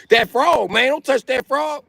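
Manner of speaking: high, drawn-out urgent warning; mock alarmed warning. Locals warning him about the frog, high mock alarm. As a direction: push the voice way up, imitating alarm.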